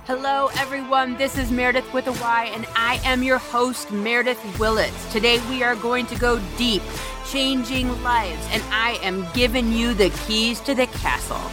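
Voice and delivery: high, sing-song host intro over music; booming sing song welcome. Acted. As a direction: announce it like a radio host.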